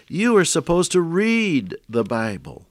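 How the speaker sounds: whimsical punchline. Retold or imitated. Preacher quoting his friend's punchline to radio listeners, lightly whimsical. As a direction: deliver the obvious answer with a wink. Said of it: "He, he would say".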